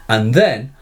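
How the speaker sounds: ordinary talk, nothing marked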